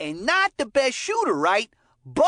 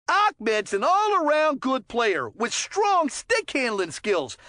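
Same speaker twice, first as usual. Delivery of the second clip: ordinary talk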